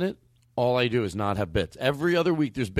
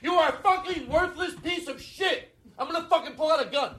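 hammed-up overreacting rage. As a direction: overdo the fury at the friend, half laughing. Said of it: "overreactor, calmer downer bit".

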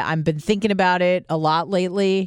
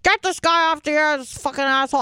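higher, mocking Bubba voice; angry dad; 9 semitones higher, faster than usual. Host imitating his dad ranting at a caller, mock angry. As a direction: play the grumpy dad, pitched up. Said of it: "my dad is Bubba".

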